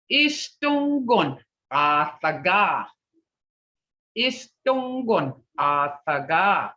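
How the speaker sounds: Istongon ataga said slowly; teacher modelling Istongon ataga slowly; slow model phrase. Shown as a demonstration. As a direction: sound out Istongon ataga carefully. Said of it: "how these sounds are being broke up".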